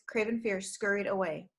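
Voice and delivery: usual speaking voice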